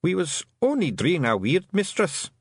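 high, cowed Scottish plea; mildly frightened little clansman; fearful. A narrator's reading. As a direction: excuse yourself timidly to the mistress. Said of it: "almost crouching in fear".

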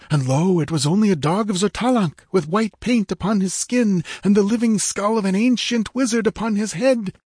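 high, urgent warrior voice; dramatic tribesman, pitched high; Techotl's excited account. Acted. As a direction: tell a dreadful tale in a high-pitched voice. Techotl tells of the fight.

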